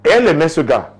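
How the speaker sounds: normal talking voice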